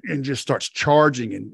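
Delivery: ordinary talk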